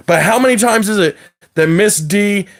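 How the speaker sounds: usual speaking voice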